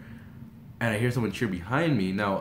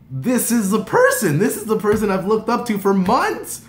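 high, loud fangirl outburst; freaking out; 10 semitones higher, 7 dB louder than usual. Retold or imitated. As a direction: gush at full volume about your idol. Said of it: "I'm freaking".

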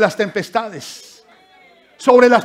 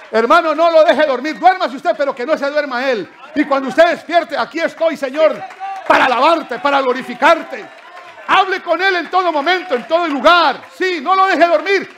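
raised, higher preaching voice pressing the point; impassioned preaching; 6 semitones higher than usual. Acted. Preacher urging the church not to let Jesus sleep, impassioned.

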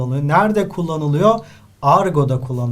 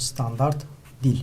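softer, with a pause between the two words; dictated answer; 4 semitones lower, 7 dB quieter than usual. Shown as a demonstration. Teacher dictating the answer to the class, soft. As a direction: say it quietly for them to note down. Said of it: "İkisinden herhangi birisini yazabilirsiniz".

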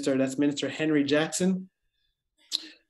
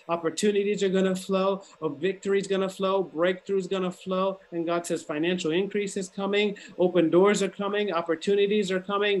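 higher-pitched, rhythmic prophetic declaration; prophetic preaching; 4 semitones higher than usual. Real, not acted. Preacher declares a new season to believers, prophetic preaching. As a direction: declare each promise in insistent, repeated phrases.

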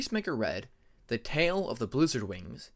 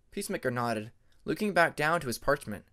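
ordinary delivery, nothing marked

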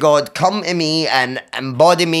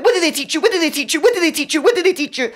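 high-pitched rapid-fire demand; retold screaming; 14 semitones higher, faster than usual. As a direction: repeat it like a frantic squealed chant. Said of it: "I'm screaming it at him".

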